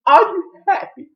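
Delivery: high, loud accusing outburst; sudden high-pitched mock shout; retold angry yell. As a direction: yell it as the fed-up mother. Retold family quarrel, mother's outburst.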